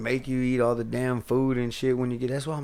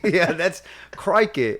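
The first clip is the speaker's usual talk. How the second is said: mock Australian; 6 semitones higher, 5 dB louder, slower than usual. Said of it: "that sound like an Irishman".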